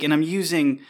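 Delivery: ordinary delivery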